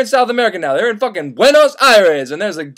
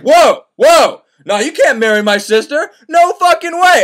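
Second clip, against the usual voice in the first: loud, high hammed-up no; mock outraged refusal; 6 semitones higher, 5 dB louder than usual. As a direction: bark a protective brother's refusal. Brother refusing marriage.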